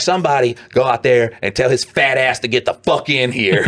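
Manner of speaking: mock boss yelling across the plant; mad plant manager. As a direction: demand like an irritated manager. Mad boss voiced in story.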